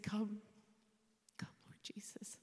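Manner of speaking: a barely voiced, tender whisper; whispered prayer. Real, not acted. Widow whispering her prayer as her husband dies. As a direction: let him go in a whisper.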